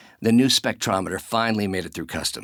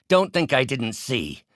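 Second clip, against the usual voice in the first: higher, forceful accusation; angry, raised-voice reproach; sharp accusation; 5 semitones higher, 3 dB louder than usual. Acted. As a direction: confront the betrayer out loud. Accusing a betrayer.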